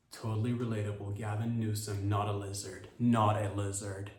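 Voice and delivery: ordinary delivery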